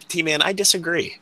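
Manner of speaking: ordinary talk, nothing marked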